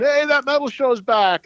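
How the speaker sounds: excited passer-by's yell, lightly imitated; imitated fan yell. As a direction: mimic a fan calling out, no real volume. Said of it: "they're, like, yelling".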